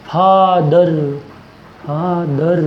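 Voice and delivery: Father drawn out in two separate beats; stressed syllables. Shown as a demonstration. Teacher showing a two-syllable example, stressed syllables. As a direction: stretch both syllables of father, fa-dar. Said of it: "मतलब जोर किस पर?".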